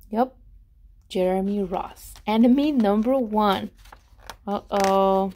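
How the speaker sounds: plain reading voice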